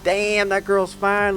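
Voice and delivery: slow, high, leering mock creep voice; mock creepy. Self-mocking vlogger imitating his creepy side, slow and high. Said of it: "and be like".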